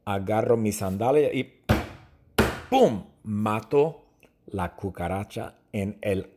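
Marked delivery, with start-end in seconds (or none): 2.7-3.0 sound effect. Retold or imitated. Imitating sandal whack on cockroach.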